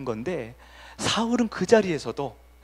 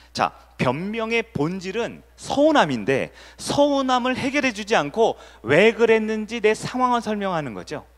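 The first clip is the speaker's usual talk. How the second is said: ordinary delivery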